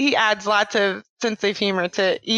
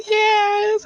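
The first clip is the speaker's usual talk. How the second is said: high, drawn-out mock sob; imitated tearful wail; 12 semitones higher than usual. Daughter answers mom about her teacher, imitated tearful wail. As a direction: wail the answer like an overcome child. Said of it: "the emotion is just overcoming her".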